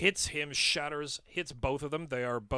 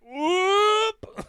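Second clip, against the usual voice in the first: high loud yelp; startled whoop; 8 dB louder than usual. Acted. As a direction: yelp and skid to a halt. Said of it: "I'm like".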